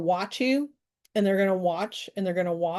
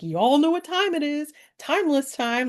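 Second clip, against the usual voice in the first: excited; 6 semitones higher than usual. Retold or imitated. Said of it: "I'm excited every time".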